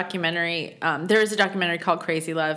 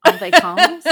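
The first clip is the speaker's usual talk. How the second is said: laughing, higher and louder En vacances; bad French; 9 semitones higher, 9 dB louder than usual. Shown as a demonstration. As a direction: En vacances tossed up as a giggly question in bad French. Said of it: "the really bad way that I learned how to speak French".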